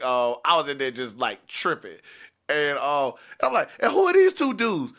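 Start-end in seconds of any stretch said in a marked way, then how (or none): 3.8-4.9 high, loud angry demand; loud challenge. Retold or imitated. Boyfriend challenging two strangers in the room, loud and angry. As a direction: confront the strangers at full bravado. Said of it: "I was being so loud".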